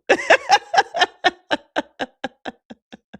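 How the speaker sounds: very high, long cackle; shrieking laugh. Real, not acted. Laughing at own joke.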